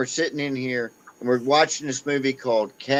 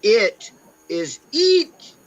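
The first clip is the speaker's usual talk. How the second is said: slow Australian demo, higher pitched; Australian accent; 10 semitones higher, 4 dB louder, much slower than usual. Shown as a demonstration. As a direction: stretch it out the Australian way, eat. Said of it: "in Australian".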